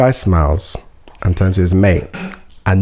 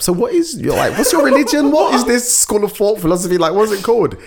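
high curious; 12 semitones higher than usual. Retold or imitated. High curious retold question.

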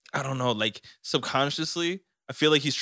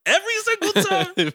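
very high, fast sarcastic echo; mocking disbelief; 6 dB louder, faster than usual. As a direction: squeak it back in disbelief. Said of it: "I'm like".

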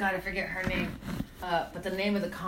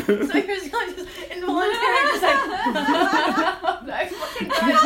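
higher and louder, laughing throughout; 11 semitones higher, 7 dB louder than usual. Real, not acted. Telling her friend the joke killed her, laughing. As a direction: laugh through every word.

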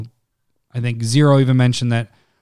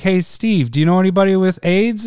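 lightly voiced joke guy asking his friend, higher; a casual setup line, a bit higher and quicker; 6 semitones higher than usual. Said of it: "He goes".

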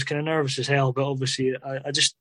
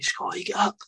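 John Robertson's retold shout; 6 semitones higher than usual. John Robertson to his injured player on the ground, mock shouting. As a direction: imitate urging a player up, half-shouted. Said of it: "John Robertson shouting at me".

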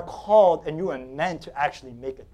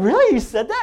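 surprised; 7 semitones higher, 5 dB louder than usual. Retold or imitated. Pastor amazed by the story.

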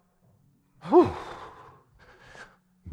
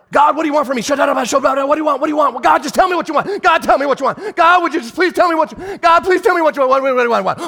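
high, loud, insistent pestering of God; frantic demanding prayer; 10 semitones higher, 3 dB louder than usual. Acted. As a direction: keep badgering God, louder each time. Voicing a frantic nagging prayer.

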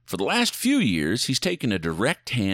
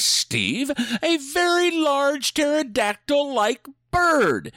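dramatic announcer; slower than usual. Acted.

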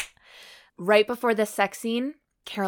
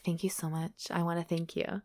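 soft breathy thank you; 3 semitones lower, 8 dB quieter than usual. Retold or imitated. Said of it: "And she's like".

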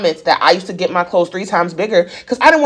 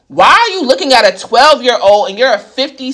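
usual speaking voice

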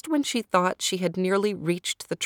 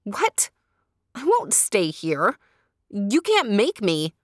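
defiant cry; 3 semitones higher, 4 dB louder than usual. A narrator's reading. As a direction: refuse him outright. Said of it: "Sapana cried".

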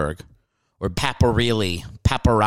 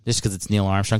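ordinary talk, nothing marked

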